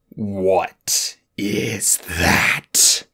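slow, low, deadly menace; a huge dragon's slow venomous question. A narrator's reading. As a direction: drag out each word like poison. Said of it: "his voice loaded with enough venom".

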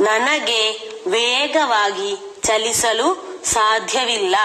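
ವೇಗವಾಗಿ drawn out slowly, word by word; slow dictation. Shown as a demonstration. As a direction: dictate slowly so they can write. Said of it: "ಇನ್ನು ಮೂರನೇ ವಾಕ್ಯ ನೋಡಿ". (ನಿಧಾನವಾಗಿ ಬರೆಸುವ ಧ್ವನಿ)

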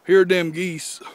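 hammed-up American yokel showing off the geese; American accent. To the Americans, presenting the geese in a mock American accent. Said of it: "go back to being Canadian".

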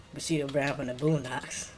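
ordinary talk, nothing marked

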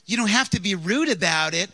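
imitating an offended woman; indignant scolding. Offended woman scolding him, retold.

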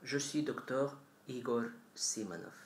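French with a heavy foreign accent; non-native speaker presenting in French. Real, not acted. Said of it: "Pardon mon français".

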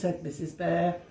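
ordinary delivery, nothing marked

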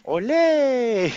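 high, loud drawn-out ole; playful matador holler; mock matador cry. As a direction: whoop like a bullfighter dodging. Plays matador dodging.

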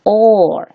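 or held long as one vowel. Shown as a demonstration. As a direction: draw out or in demonstration. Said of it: "它就发长元音".

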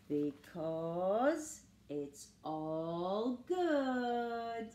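lively picture-book storytelling; reading to children. A narrator's reading. Reading Pete the Cat to listening children, lively storybook voice. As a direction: read it like bedtime story time for kids.

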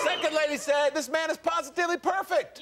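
high, rousing announcer call; showman read-out. Acted. Host reads a lady's praise aloud, rousing announcer voice. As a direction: proclaim it for the studio crowd.

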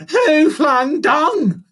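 high, loud, stretched-out monkey demand; demanding shout. A narrator's reading. Monkey confronting the lion, demanding shout. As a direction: demand it slowly at the top of your voice. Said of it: "Furley demanded to know".

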